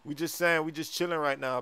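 ordinary delivery

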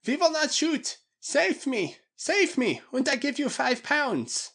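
very high, pleading falsetto; panicked high-voiced bargaining; Bloober crying out. A narrator's reading. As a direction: beg frantically to be rescued. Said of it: "he cried".

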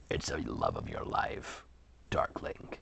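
low, soft teasing sister; teasing whisper. Acted. Sister teasing Dawn about her crush, whispered.

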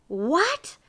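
high, rising, incredulous what; dismayed. A narrator's reading. Miser hears he must work first, dismayed. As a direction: cry it in disbelief.

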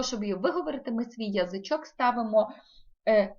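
usual speaking voice

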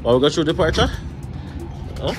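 ordinary delivery

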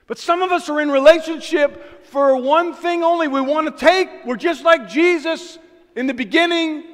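loud, high fervent preaching; preaching at full force. Real, not acted. Preacher rallying the church with loud, high fervor.

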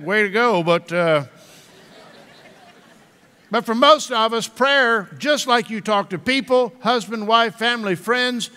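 ordinary talk, nothing marked

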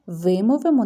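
ordinary talk

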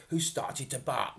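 ordinary delivery, nothing marked

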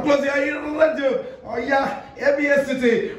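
high, hyped jingle call; radio station shout-out. Acted. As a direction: sell the station name like a jingle.

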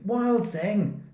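high, loud scolding call; mother calling out. A narrator's reading. Mother scolding naughty Max, loud high call. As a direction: scold him like a cross mother. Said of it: "His mother called him".

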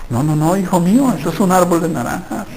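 calm him like a patient father; gentle God voice. Pretend. God gently answering his child.

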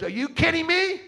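high, loud disbelief; incredulous scoff. Retold or imitated. Girl mocking the preacher's invitation, high incredulous scoff. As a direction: scoff it like a teen who can't believe it.

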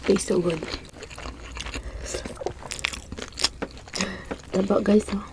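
chewing while talking; muffled through food; mouth full. Real, not acted. Seen 2: Both diners chew throughout, cheeks full; unclear which one speaks.

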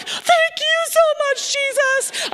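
very high, wailing thanks; mock crying. Minister tearfully thanking Jesus after the call, imitated crying. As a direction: cry it out to heaven, hammed-up. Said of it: "I am crying and I'm like".

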